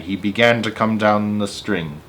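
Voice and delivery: ordinary delivery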